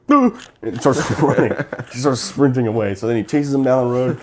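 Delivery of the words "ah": high loud startled Ah; panicked yelp. Retold or imitated. Crackhead Jimmy spots the cop's lights, panicked loud yelp. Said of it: "Jimmy sees it and goes".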